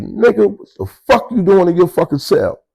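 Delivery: enraged yelling. Retold or imitated. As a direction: tear into him for hiding in his cell. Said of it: "So I was so enraged".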